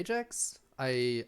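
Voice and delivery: normal talking voice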